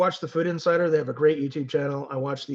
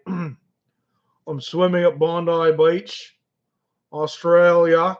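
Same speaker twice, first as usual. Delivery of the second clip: very slow, louder Australian accent attempt; 6 dB louder, much slower than usual. Shown as a demonstration. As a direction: drawl it out like a hammed-up Aussie. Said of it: "my Australian accent isn't the best, but I can try".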